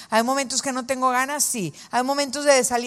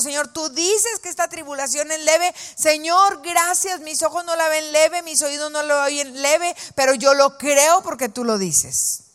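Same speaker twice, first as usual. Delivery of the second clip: higher, earnest prayer voice; 5 semitones higher than usual. Acted. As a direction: pray it out as a model for the women. Ora en voz alta, modelo de oración. (oración ferviente en voz más aguda)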